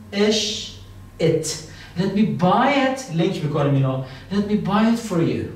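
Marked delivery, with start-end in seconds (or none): 2.0-3.2 slow, stretched-out model sentence; teacher's slow drill; slow model phrase. Shown as a demonstration. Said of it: "slow teaching model". 4.3-5.4 slow, stretched-out model sentence; teacher's slow drill; slow model phrase. Shown as a demonstration. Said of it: "slow teaching model".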